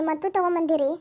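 usual speaking voice

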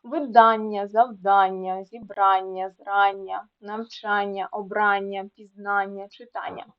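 teacher's list from Видання to читання with stressed -АННЯ. Shown as a demonstration. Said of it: "слова з наголосами на ання".

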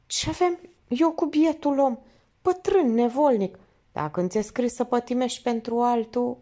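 a hint of a poor man's lament; resigned driver.

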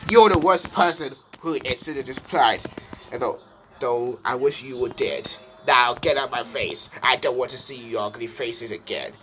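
a villain's loud telling off; angry rant. Acted. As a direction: yell him out of your sight. Dark Bowser tells the brat off.

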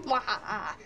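low, quiet villain cackle; evil laugh. Pretend. Villain laughs after summoning minion.

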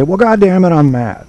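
imitating a comedian fed up on stage; mock mad. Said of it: "then he would say a joke and say".

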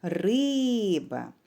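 long stressed ри, short ба; calling the word. Shown as a demonstration. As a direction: call out риба as if hailing it: ри-и-иба. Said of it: "необхідно слово погукати".

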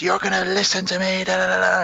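high, strained mock yell; mock scream. Podcaster retelling the bully's rant at Andy, high-pitched mock scream. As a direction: imitate a bully screaming in someone's face. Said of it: "really screams at him".

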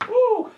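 high, loud whoop of relief; triumphant shout of woo; excited whoop. Real, not acted. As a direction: let out a big cheer. Cheering after finishing the run.

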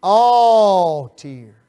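"All" hit hard, loud and high, as a preaching demo; stress on ALL. Shown as a demonstration. Preacher showing the congregation how to stress "All". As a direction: lean on "All" with a big loud falling push. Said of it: "You better emphasize that word".

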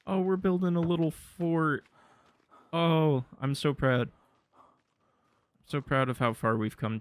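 a hint of a doting parent; mock proud parent. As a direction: coo over the fort like a proud parent. Proud of little fort.

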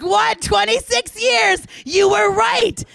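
loud, high acted exclamation; mock outburst. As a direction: call it out in hammed-up amazement. Acts out loud boast to friends.

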